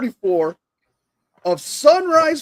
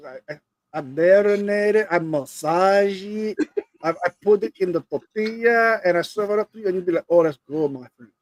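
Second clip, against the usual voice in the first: Eddie Guerrero impression; 2 semitones lower than usual. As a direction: sweet-talk them about your pork in a light Mexican accent. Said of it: "Why do you sound like Eddie Guerrero?".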